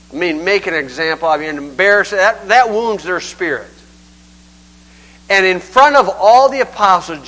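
ordinary delivery

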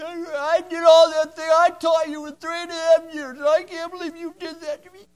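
high, loud wounded complaint; manipulative outburst. Retold or imitated. Jesus guilt-tripping Peter as a bad example, high and loud. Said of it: "that was manipulation".

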